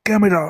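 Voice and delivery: "Camera" shot out in a single burst; short, falling, emphatic "camera"; forceful, one second. Shown as a demonstration. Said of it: "একবারে জোরে হবে".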